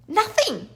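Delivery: Nothing. said high and final; emphatic nothing. Acted. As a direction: land "Nothing." hard and high. Emphatic insistence.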